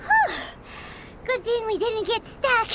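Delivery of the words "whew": relieved whew. Acted. Relief after the obstacle course.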